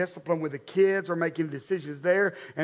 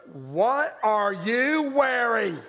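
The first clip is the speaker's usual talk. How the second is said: slow, drawn-out mock disbelief; mock aghast; 4 semitones higher, much slower than usual. Wife inspecting the kids' outfits, drawn-out mock horror. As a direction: stretch out each word in exaggerated dismay.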